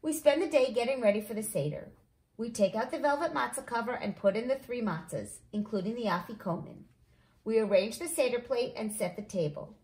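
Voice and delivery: ordinary delivery, nothing marked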